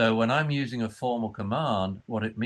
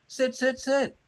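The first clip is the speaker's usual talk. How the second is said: much higher repeated "Sit, sit, sit"; high urgent commands to a dog; sharp high commands; 14 semitones higher than usual. Retold or imitated. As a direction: snap it higher each time.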